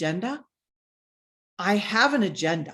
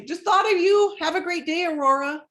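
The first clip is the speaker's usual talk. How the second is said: bright friendly note; 8 semitones higher, 4 dB louder than usual. Retold or imitated.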